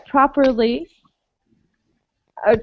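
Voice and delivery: usual speaking voice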